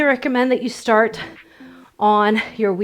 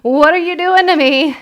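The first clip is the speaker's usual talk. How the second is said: high, loud whiny protest; mock exasperation; 5 semitones higher, 4 dB louder than usual. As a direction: playfully complain like a worn-out student. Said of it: "if you are like".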